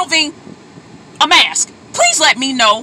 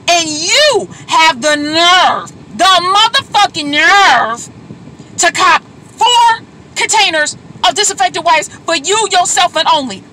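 furious; 2 semitones higher than usual. Real, not acted. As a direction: spit out each word slowly. Furious at hoarders.

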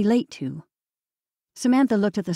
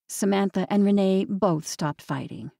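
usual speaking voice

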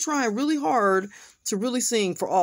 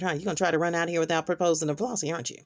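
ordinary talk, nothing marked

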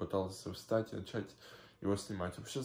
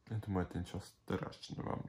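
ordinary talk

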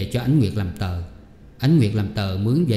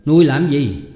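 husband glaring and snapping at his wife, higher and louder; sharp sarcastic scolding, pitch raised; 6 semitones higher, 5 dB louder than usual. Acted. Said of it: "trợn mắt ngó vợ mà nói rằng". (giọng chồng gắt gỏng mỉa mai vợ)

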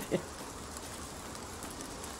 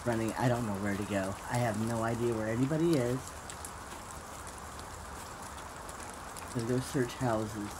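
quiet; 14 semitones lower, 12 dB quieter, faster than usual. Real, not acted. Hiding, talking low.

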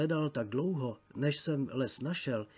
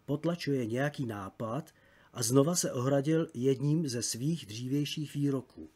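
plain reading voice

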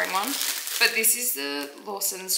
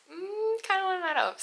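quoted student's reply; 12 semitones higher than usual. Retold or imitated. Student answering her coach about skipping training, high and hedging. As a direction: imitate a hesitant reply, pitched way up. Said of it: "but she goes".

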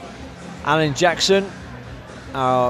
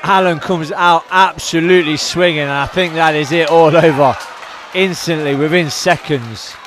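louder, urgent knockout call; raised-voice live play-by-play; excited fight commentary; 5 dB louder than usual. Real, not acted. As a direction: call the sudden finish as it happens. Commentator calls instant knockout.

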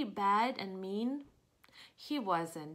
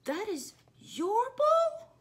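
much higher, slow disbelieving question; astonished Piggy; 11 semitones higher, 5 dB louder, slower than usual. Acted. Reading to kids, Piggy amazed the ball is the whale's. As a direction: squeak it in disbelief.

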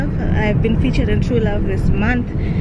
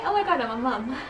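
normal talking voice

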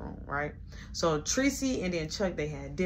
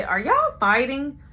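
put-on kid voice; 7 semitones higher, 7 dB louder, slower than usual. Kid asking about a fight.

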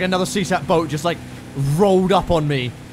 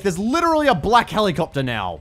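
usual speaking voice

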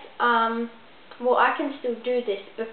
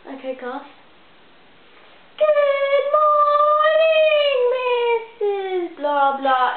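drawn-out, high singing chant of a class; slow chorus of schoolkids; sing-song class greeting; 12 semitones higher, 6 dB louder, much slower than usual. Retold or imitated. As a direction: stretch it like kids chanting good morning. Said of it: "the teachers are just like".

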